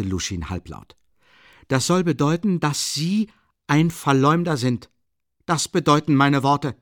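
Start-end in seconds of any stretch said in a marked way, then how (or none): 1.7-6.8 raised, high-pitched vehement accusation. Acted. Accuser confronting the man he calls a slanderer, vehemently. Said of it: "sagte Lebesjetnikow heftig".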